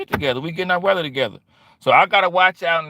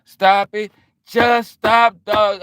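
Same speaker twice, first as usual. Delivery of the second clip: mock exasperated plea; 5 semitones higher, 5 dB louder, much slower than usual. Shooing off young women.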